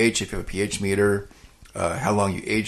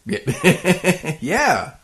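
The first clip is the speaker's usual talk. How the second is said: laughing; 9 semitones higher, 3 dB louder than usual. Real, not acted. Laughing at the rename joke.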